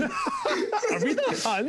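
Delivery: mock-alarmed question, laughing; high, slow laughing. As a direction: stretch it out through a laugh. Echoing baddies joke.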